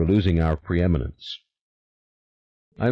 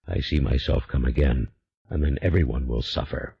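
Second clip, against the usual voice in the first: low, unhurried otherworldly demon, calm and sure; a patient spirit promising a way back; 6 semitones lower than usual. A narrator's reading. The demon instructs Julien in a low, calm voice.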